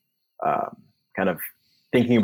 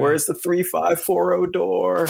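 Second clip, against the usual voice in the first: slow, high, louder bewildered calling; lost and groping; 8 semitones higher, 3 dB louder, much slower than usual. Retold or imitated. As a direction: grope for the door in the dark. Lost player in dark room.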